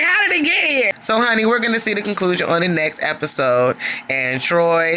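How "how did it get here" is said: much higher bewildered squeal; shocked squeal. Retold or imitated. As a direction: gasp it out in disbelief. Kendra's shocked reaction.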